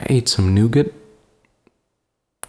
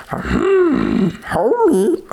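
Homer Simpson voice. Pretend. Still doing the Homer voice.

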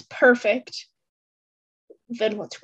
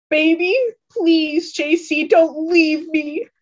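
slow, higher, begging plea; slow hammed-up sobbing plea; pleading sob; 5 semitones higher, 4 dB louder, slower than usual. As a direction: drag out the words as if breaking. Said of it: "hugs J C ... and sobs".

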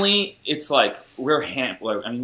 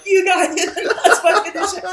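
much higher, laughing shout; explosive group cheer; 5 dB louder than usual. Retold or imitated. Friends cheering Brock after the nut shot, high laughing whoop. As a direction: whoop it out in disbelief. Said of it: "we all went like".

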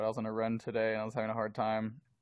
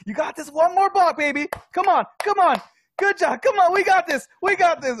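much higher, louder cheering; hype man; 12 dB louder than usual. Retold or imitated. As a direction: pump yourself up like a coach. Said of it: "my biggest hype man".

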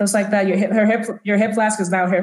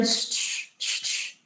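soft, voiceless shh sounds; imitated shuffling sound; 11 dB quieter, slower than usual. Game master imitating a sound heading for the doors, hushed shh. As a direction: imitate a repeated swishing sound, very softly. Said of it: "'cause you just hear".

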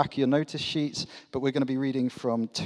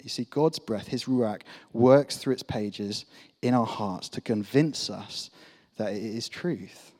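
ordinary talk, nothing marked

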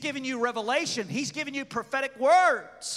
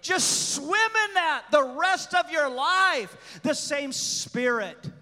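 loud, rising sermon delivery; preaching at full force; 4 semitones higher, 4 dB louder than usual. Acted.

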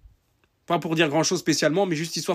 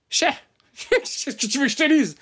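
mocking shrug; 9 semitones higher than usual. Mocking reply to complainer.

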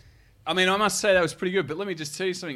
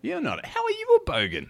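incredulous; 14 semitones higher than usual. Real, not acted. Incredulous at friend's claim.

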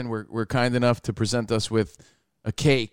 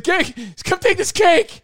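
high-pitched, drawn-out panicked plea; mock panic; slower than usual. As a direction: imitate a friend begging for rescue, hammed-up. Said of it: "almost in a panic".

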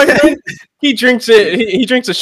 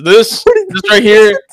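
put-on slow, drawn-out drunk dad; lightly voiced tipsy dad; mock drunk dad; 3 semitones higher, 4 dB louder, much slower than usual. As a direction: stretch it out like the proud drunk dad.

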